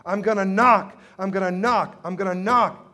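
loud, insistent plea building to a shout; pleading with forceful, driving repetition; persistent widow. Acted. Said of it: "is the persistent widow".

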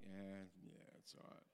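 soft, low shrug of a voice, quick at the end; feigned indifference. Acted. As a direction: play it down so nobody wants it. Acted feigned indifference about the pastry.

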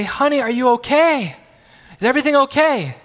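calling downstairs. Retold or imitated.

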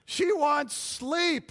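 high, drawn-out whiny wife voice; tired wife voice. Retold or imitated. Preacher voicing a weary wife, high and drawn out. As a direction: drag it out like an exhausted wife.

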